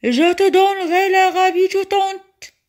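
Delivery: high, loud desperate promise; genie yelling. A narrator's reading. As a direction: holler it as you are thrown away. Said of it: "Le génie hurla encore".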